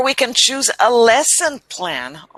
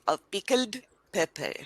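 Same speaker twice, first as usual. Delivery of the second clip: put-on "Of pickled pepper" softer, Indian accent, final r dropped; Indian-style "Of pickled pepper" with unpuffed p's; imitated Indian accent; 9 dB quieter than usual. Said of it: "You say".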